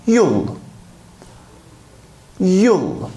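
"you'll" said as one syllable, like yool; teacher modelling "you'll"; model pronunciation. Shown as a demonstration. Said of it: "Bunun okunuşu arkadaşlar".

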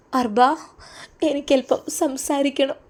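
put-on young woman's voice. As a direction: ask shyly to speak in private. Farsana asks to talk.

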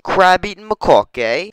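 high, loud British lilt with rising end; mock British accent. Repeating the monkey's name in mock British accent. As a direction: say it in hammed-up British. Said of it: "It's British".

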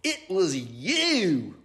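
high, drawn-out accusation; slow, high mock shout; possum's shout. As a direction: stretch out the accusing cry. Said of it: "Perry shouted".

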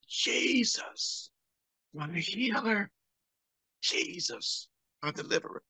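soft, breathy whisper to Jesus; patient whispering thanks as the tube comes out; whispered prayer. Retold or imitated. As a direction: pray under your breath in awe. Whispered prayer as device removed.

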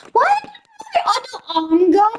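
high laughing yelp; startled laughing shout. Real, not acted. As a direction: shriek it through a laugh. Panicked at lost arm.

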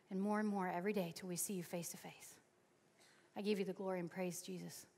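soft, low closing prayer; closing prayer, softly. Acted. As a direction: pray quietly over the room.

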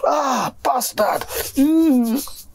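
a high, slow yelp of pain, imitated; mock pained yelp. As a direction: act out the sudden sting of the bite, half-hearted. Friend bitten by snake, retold.

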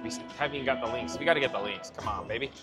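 ordinary delivery

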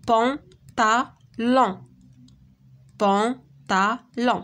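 each syllable of بونطالون on its own, with gaps between. Shown as a demonstration. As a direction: clap out بونطالون as three separate beats. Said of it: "دابا غادي نشوفو في اين سيلاب". (بونطالون مقطعة سيلاب بسيلاب)

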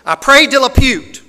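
very loud, high impression of a mom; full-volume imitated outburst; Miss Sherry. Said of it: "that sounds just like Sherry".

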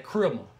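low "Criminal" slurred to "crimmo"; imitated low Florida "Criminal", rounded ending; Florida pronunciation. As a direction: drop the pitch and swallow the end of "Criminal". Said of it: "how do you spell that?".

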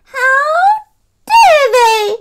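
high, loud outraged cry; a cat furious at being left out. A narrator's reading. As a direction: shriek it in hurt outrage. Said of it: "Sushi cried".

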